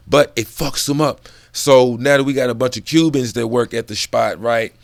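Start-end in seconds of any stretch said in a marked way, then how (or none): none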